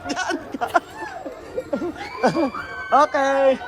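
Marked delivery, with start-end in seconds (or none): 0.7-2.9 high shrieking ride scream; screaming laugh. Real, not acted.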